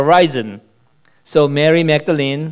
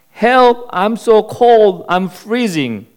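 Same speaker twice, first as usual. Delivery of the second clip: high, pleading calls for help; desperate cries; 7 semitones higher, slower than usual. Acted. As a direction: cry out, cold and desperate. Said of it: "heard the desperate cries".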